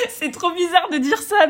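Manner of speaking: laughing through the words, pitch jumping up; en riant. Real, not acted. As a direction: giggle as you admit it's odd. Laughs while admitting something odd. (en riant aux éclats)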